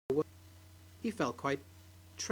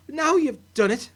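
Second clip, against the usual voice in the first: scolding wife; 8 semitones higher, 7 dB louder than usual. Acted. Angry wife scolds husband.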